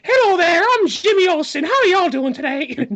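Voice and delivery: over-the-top friendly announcer voice; cheesy all-American greeter. Retold or imitated. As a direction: play a hammy goody-goody introducing himself. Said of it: "I don't think of him as like".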